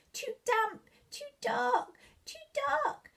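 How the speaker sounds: high, tiny squeaking mouse; squeaky mouse voice. Acted. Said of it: "Mouse squeaks".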